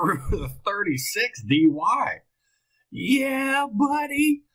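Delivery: high-pitched, giddy disbelief and delight; excited. Real, not acted. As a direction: get carried away, voice leaping up. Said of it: "I got all excited".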